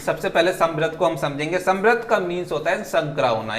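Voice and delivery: ordinary talk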